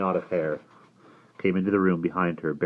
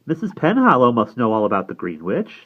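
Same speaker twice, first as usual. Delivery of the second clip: higher-pitched American woman's voice; soft American accent; 6 semitones higher, 8 dB louder than usual. A narrator's reading. Fran bringing Missus Penhallow into the talk, American accent. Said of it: "in her soft American voice".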